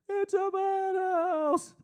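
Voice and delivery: very high, stretched-out melodramatic wail; hammed-up movie anguish, long and high; Charlton Heston. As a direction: wail it slowly like a desperate film hero. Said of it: "like Charlton Heston in Planet of the Apes".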